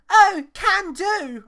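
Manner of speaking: high, loud and very slow cheery reply; loud high cheerful can do; young [name] voice. Retold or imitated. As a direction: chirp it out eagerly. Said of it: "I hate my voice".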